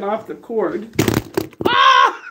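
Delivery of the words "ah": sudden high yelp of alarm; a real shriek as she stumbles; startled scream. Real, not acted. Tripping on the cord, she lets out a real scream. Nearly falls getting off cord.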